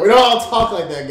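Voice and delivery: loud, high mock protest; hammed-up outraged objection; offended German commenter. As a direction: burst out as an indignant German chatter. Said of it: "Then someone German comes in the chat".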